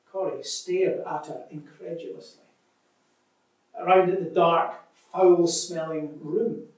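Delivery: lively read-aloud with voiced sisters; story reading. Preacher reading a story aloud to church, lively.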